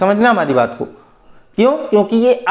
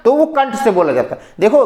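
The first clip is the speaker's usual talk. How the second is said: ordinary talk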